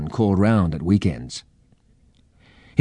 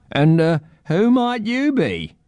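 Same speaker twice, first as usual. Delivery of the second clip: slow, high character voice; eccentric old bird-man; 10 semitones higher, slower than usual. A narrator's reading. Old bird-man asks the children's names, slow and high.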